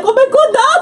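high-pitched, loud mock gushing; mock winner's excitement. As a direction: squeal it like a shocked contest winner, half laughing. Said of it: "She coming out like".

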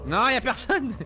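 loud, tense and jumpy; nervous shout into empty room; scared horror game streamer. Real, not acted. Tense bank search in horror game.

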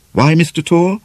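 plain reading voice

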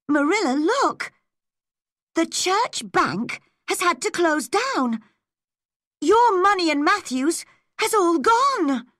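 high, distressed exclamation; shocked. Acted. Anne telling Marilla the bank has closed, shocked. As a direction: break the bad news in high-pitched alarm.

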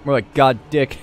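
ordinary delivery

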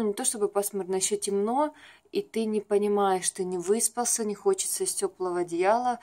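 ordinary delivery

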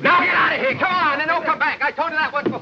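fed-up boss throwing out the singers; exasperated shout. Acted. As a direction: chase the singers out of the office.

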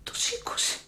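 whisper. Real, not acted. Secret phone call, hiding.

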